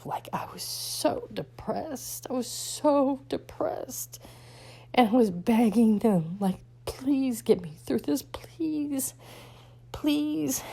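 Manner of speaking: real crying voice, high and breaking into whisper; choked up, pitch climbing as the tears come; tearful. Real, not acted. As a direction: plead through tears, drawn out and high. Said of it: "only reason I'm crying right now".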